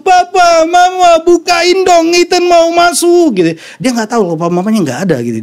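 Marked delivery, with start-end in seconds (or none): 0.0-3.4 put-on high, loud wail of a locked-out child; imitated child's yelling. Father imitating his son banging on the locked door, high loud yelling. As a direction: cry out for the parents, stretching the last words. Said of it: "dia nangis teriak dengan kencang".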